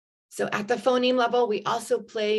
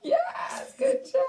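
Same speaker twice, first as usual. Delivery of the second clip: excited praise; slower than usual. Real, not acted. Praising child after laughter.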